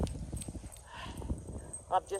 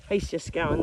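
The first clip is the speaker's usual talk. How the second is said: usual speaking voice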